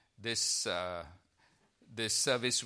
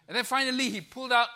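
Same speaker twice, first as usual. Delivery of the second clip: hyped narration; 10 semitones higher, 6 dB louder, faster than usual. Retold or imitated. Excited narration of climax.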